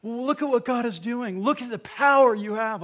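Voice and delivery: high, loud, amazed cries of wonder; awestruck angels. Retold or imitated. Angels praising God at creation, high and amazed. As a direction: gasp in awe at the creation. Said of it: "they were like going".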